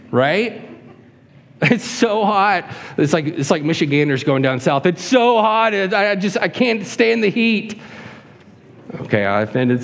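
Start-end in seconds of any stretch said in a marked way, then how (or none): 1.6-2.7 put-on high, drawn-out childish whine; fussy baby. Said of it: "This is like a fussy baby". 4.9-7.8 high-pitched mock whining; fussy baby. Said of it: "This is like a fussy baby".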